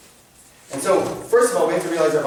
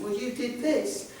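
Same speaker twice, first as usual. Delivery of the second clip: put-on mild tearful child; 9 semitones higher, 7 dB quieter, slower than usual. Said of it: "crying, just weeping".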